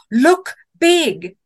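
loud, high, punched-out instruction; emphatic command. A narrator's reading. Camper recalling what she read about bears, emphatic command. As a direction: declaim each word like an urgent rule.

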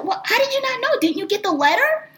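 very high-pitched incredulous questioning; imitated surprise. Representative asking about the letter, mock incredulous. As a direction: ask as if it were obvious. Representative amazed she didn't know.

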